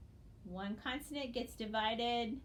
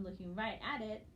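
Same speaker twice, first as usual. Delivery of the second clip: normal talking voice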